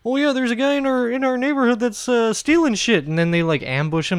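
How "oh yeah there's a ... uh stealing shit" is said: high, put-on complainer; mock excuse-maker. Mocking the ambushers' excuse, in a high put-on voice. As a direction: pitch it up as a mock neighbour. Mimicking the men who made excuses.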